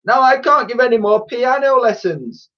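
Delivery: high, loud wail of complaint; distraught, high-pitched outburst; dismayed cry. A narrator's reading. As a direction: cry it out over the ruined piano. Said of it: "she cried from inside".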